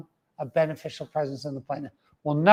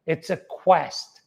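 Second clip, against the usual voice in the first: usual speaking voice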